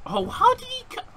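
very high, incredulous squeal; astonished squeal. Real, not acted. Gamer astonished by opponent's move, astonished squeal. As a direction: leap up high in disbelief.

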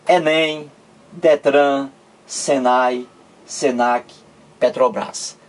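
enem, detran, senai, senac, Petrobras each said whole in syllables; read as words. Shown as a demonstration. Teacher listing examples pronounced by syllables, read as words. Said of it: "em vogais e em sílabas".

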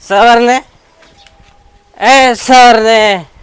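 loud drawn-out call of her name. Acted. Husband calls loudly for his wife. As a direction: shout for her across the house, slowly.

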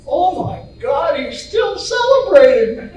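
loud, incredulous punchline delivery; mock amazement. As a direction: act out an astonished wife, rising to the punchline. Wife's punchline exclamation in joke.

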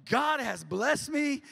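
slow, loud, high exultation; mock jubilant proclamation. Bargain hunter celebrating a crazy good car deal, jubilant slow proclamation. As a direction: draw it out loudly like a triumphant praise shout. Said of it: "when y'all find a good deal, you're like".

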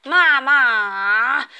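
loud, whiny call to mum; a kid wailing for mum; child whining out loud. Acted. Child whining at mum to stay home.

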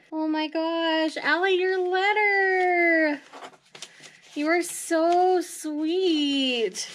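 high, slow, drawn-out gush; overwhelmed by a sweet gift; real teary-sweet gratitude, stretched out; touched, near tears. Real, not acted. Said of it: "I'm honestly gonna cry".

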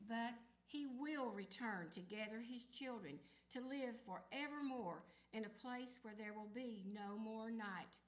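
usual speaking voice

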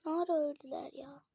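soft, puzzled question to herself; quiet wondering. Real, not acted. Gamer to viewers, quiet puzzled repeat. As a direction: murmur it, still baffled. Repeats after loud take.

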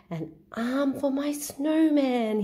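a hint of a gleeful boy. Boy finds an arm.

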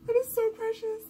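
high, loud gushing; gushing squeal. Real, not acted. Fan gushing about the boyfriend's reservation, high and loud. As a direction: squeal over how precious it is.

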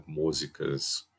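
ordinary talk, nothing marked

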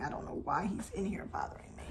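hushed aside, barely voiced; whisper. Real, not acted. Whispering about husband who keeps interrupting filming. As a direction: whisper so the husband won't hear.

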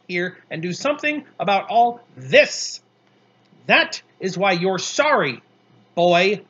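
loud, high, relentless scolding; indignant dressing-down, pushed loud; angry tirade. A narrator's reading. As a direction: list his offences, hammering the repeated word.

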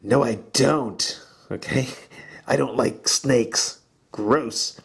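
slow, drawn-out mock disgust at snakes; hammed-up yuck for the class. Shown as a demonstration. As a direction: pull a grossed-out face and let it show in the voice. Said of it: "Show it with your facial expression".

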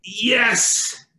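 high, loud, drawn-out cry; triumphant shout. Acted. Doctor as the baby's head appears, shouting in triumph. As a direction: shout it out as the baby arrives. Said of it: "and the doctor says".

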